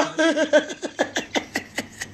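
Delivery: high, breathy real laugh; real laughter. Real, not acted. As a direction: laugh freely at yourself. Laughing after her joke.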